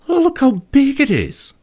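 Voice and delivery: put-on high, loud amazed girl; marveling. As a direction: gasp it out high in wonder. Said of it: "Kat marveled".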